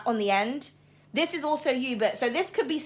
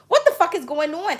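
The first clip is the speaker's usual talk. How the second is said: ordinary delivery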